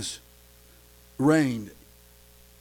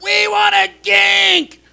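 high, slow mock crowd shout; clamor like a whining multitude; crowd crying out; 10 semitones higher, 6 dB louder, slower than usual. Israelites clamoring to Moses for water, in a mock child's shout.

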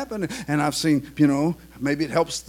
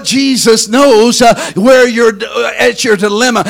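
loud, fiery, shouting sermon; full-force preaching; 8 semitones higher, 13 dB louder than usual. Acted. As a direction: preach it at the top of your lungs.

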